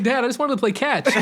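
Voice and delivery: put-on high-pitched child protesting to dad; mock pleading kid. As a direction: play a hapless son, half laughing. Voices the frightened child.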